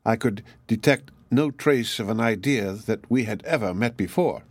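ordinary delivery, nothing marked

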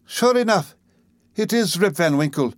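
put-on a tottering old woman's cry of recognition; old woman exclaiming. A narrator's reading. Old woman recognising Rip in the crowd, excited exclaiming.

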